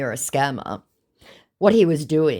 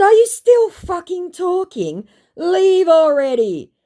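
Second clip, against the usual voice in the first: very high, loud, drawn-out exasperation; hammed-up fed-up outburst; exasperated retort; 9 dB louder, slower than usual. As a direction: stretch it out in theatrical disbelief. Retells her exasperated retort.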